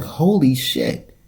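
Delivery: slow, stunned holy shit; retold shock. Seeing a huge woman on the train, stunned. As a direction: drag it out in disbelief. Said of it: "And I was like".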